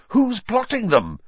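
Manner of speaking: lightly voiced boy, pleading; a hint of a desperate boy; boy Harry.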